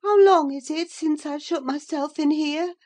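high, loud sudden question; high-pitched mistress coming back to life; reviving invalid. A narrator's reading. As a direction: rouse suddenly and ask in a high voice. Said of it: "suddenly reviving".

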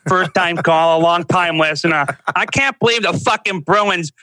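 loud, high-pitched angry Boston fan caller; worked-up Boston caller ranting on the radio. Retold or imitated. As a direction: rant like a fed-up sports radio caller. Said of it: "I can just picture some guy fucking calling in the Boston radio".

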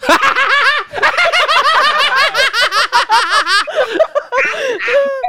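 long, high-pitched burst of laughter; real, helpless high laughter; laughing hard. Real, not acted. Amused by the form joke.

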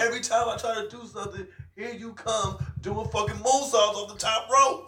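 high, rushed pretend tirade; mock rant. Becky griping about rival's moonsaults, mock rant. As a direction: rattle out the grievance high. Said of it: "I want Becky to fucking snap".

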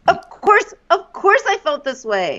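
high, aggrieved imitation of the woman; playing Kaylin. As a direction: throw it back with mock hurt, stressing "course". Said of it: "I'll be Kaylin".

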